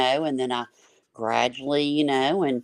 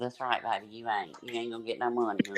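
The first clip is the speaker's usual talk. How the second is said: quiet teasing; 2 semitones lower, 7 dB quieter, faster than usual. Retold or imitated. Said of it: "I said".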